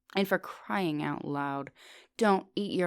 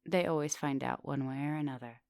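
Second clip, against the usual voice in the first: ordinary talk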